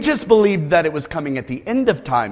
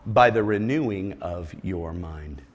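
soft slow; 11 semitones lower, 9 dB quieter than usual. Acted. Quiet scripture completion.

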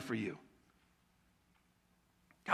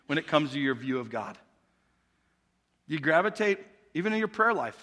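normal talking voice

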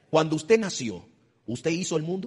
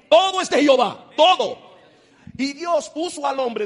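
very high, loud proclamation; preaching shout; 12 semitones higher, 9 dB louder, slower than usual. Real, not acted. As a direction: thunder it out to the church. Preacher proclaiming God owns all.

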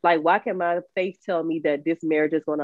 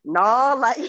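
laughing; 7 semitones higher than usual. Real, not acted. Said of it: "laughing dismissal".

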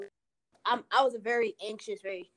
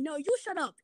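retold yelling back; 6 semitones higher than usual. Girl answering back in a quarrel, mock yelling. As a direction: imitate a squabbler firing back in a raised pitch. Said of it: "they're yelling".

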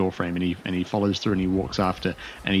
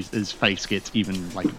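usual speaking voice

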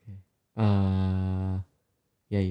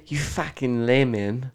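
slow South African insult; mock South African telling-off; South African accent; 3 semitones higher, slower than usual. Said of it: "it's, like, South African accent".

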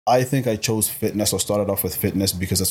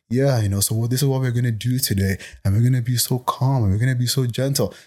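soothing parody voice, slightly raised in pitch; mock calm life coach; 3 semitones higher than usual. Coach mimicking most life coaches being so calm, so gentle, mock-soothing. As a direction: put on an exaggeratedly gentle coach patter. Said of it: "most life coaches like".

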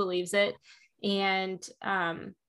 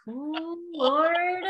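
exasperated sigh; 9 semitones higher than usual. Retold or imitated. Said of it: "And I'm like".

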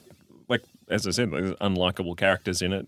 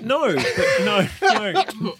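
high protesting no; 11 semitones higher, 4 dB louder, much slower than usual. Real, not acted. Protesting the insult.

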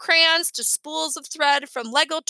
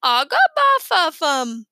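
put-on baby babble; 3 semitones higher, 5 dB louder, slower than usual. A narrator's reading. Baby Tootsie babbling proudly to the family. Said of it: "Tootsie was very pleased with herself. She said".

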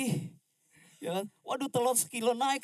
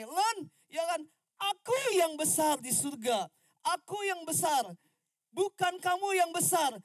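God reminding; 8 semitones higher, 5 dB louder, slower than usual. Retold or imitated. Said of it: "Tuhan ingatkan saya begini".

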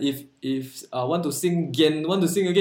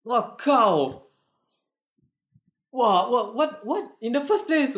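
high incredulous exclaims; can't believe what he hears; surprised disbelief; 7 semitones higher, slower than usual. Real, not acted. Reacts to airport mix-up.

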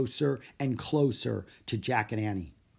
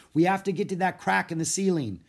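loud, high urgent call; shout; 7 semitones higher, 5 dB louder than usual. A narrator's reading. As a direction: call over the water. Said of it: "he shouted".

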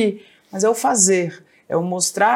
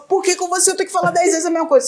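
high, rapid exasperated nagging; mock scolding; 9 semitones higher, faster than usual. Mom nagging her son, exasperated and high. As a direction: fire off a retold telling-off in a raised pitch.

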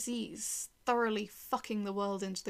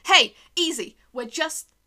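loud, high urgent protest; alarmed shout; 7 semitones higher, 14 dB louder than usual. Acted. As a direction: call out to stop an attacker, cut off mid-sentence. Pleading as armed man appears.

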